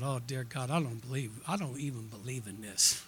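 low, soft grumble to God; quietly appalled, voice dropped low; dismayed inner prayer. Retold or imitated. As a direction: grumble privately under your breath.

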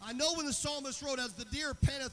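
high, very loud preaching, building to a shout; impassioned preacher at full cry, stretching key words; full-force preaching. Real, not acted. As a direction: drive it to a shouted pulpit climax.

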